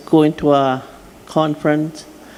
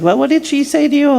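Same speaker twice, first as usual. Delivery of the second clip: higher, eager question to mom; pressing question; 10 semitones higher, 3 dB louder, faster than usual. Retold or imitated. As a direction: ask again, pitched well up. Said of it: "And I said".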